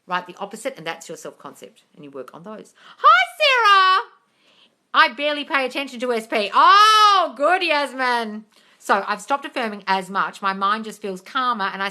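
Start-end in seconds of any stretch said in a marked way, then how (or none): 3.0-4.1 much higher, louder greeting; bright high greeting; sing-song hello. Real, not acted. 6.5-8.5 higher, louder, slow praise; drawn-out delighted praise; gushing. Real, not acted.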